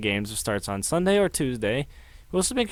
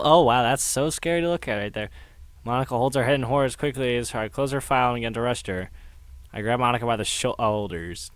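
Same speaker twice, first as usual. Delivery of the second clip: ordinary delivery, nothing marked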